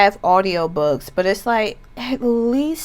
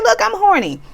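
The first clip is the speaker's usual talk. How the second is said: amused mock quote; 12 semitones higher, faster than usual. Quoting energy, still amused.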